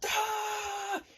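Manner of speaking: high, held mock scream; imitated scream. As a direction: wail like the falling guy. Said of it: "screaming like this".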